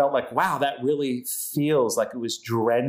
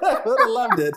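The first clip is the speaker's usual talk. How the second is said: laughing; 11 semitones higher than usual. Real, not acted. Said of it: "repeated line".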